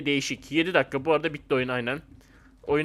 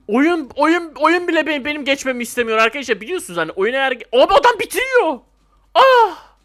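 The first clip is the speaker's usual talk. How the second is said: high, loud frustrated whine; high loud griping ending in a cry; exasperated complaint; 9 semitones higher, 6 dB louder than usual. Real, not acted. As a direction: complain the game hates you. Complaining game is against him.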